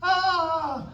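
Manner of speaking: put-on high, held baby "ah"; imitated baby squeal. Dad imitating his smiling baby, high squeal. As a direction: mimic a baby's high cry. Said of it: "she'll just smile and go".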